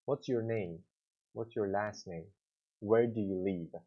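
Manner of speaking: tune falls at the end of each question; falling intonation. Shown as a demonstration. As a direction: drop the voice at the end. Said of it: "information questions are having a, uh, falling intonation".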